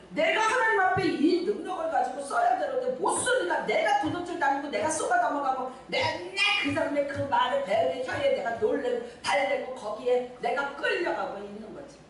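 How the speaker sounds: normal talking voice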